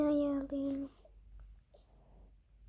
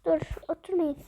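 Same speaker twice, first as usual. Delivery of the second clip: ordinary delivery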